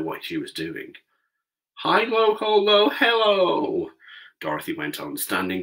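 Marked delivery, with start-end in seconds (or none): 1.8-3.9 slow, high chanted spell; magic incantation. Acted. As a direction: intone it like a spell. Reciting the magic charm.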